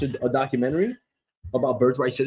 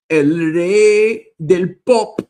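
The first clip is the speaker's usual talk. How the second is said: loud, very slow, drawn-out Spanish; grandiose announcement; 5 semitones higher, 8 dB louder, much slower than usual. Pretend. Host proclaiming king of pop in Spanish, grandiose. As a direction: belt it out syllable by syllable.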